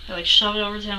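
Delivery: ordinary talk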